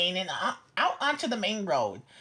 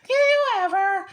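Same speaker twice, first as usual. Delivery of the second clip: higher, louder astonished grandma; gasped; 8 semitones higher, 6 dB louder than usual. A narrator's reading. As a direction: gasp it out, shocked grandma. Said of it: "Grandma gasped".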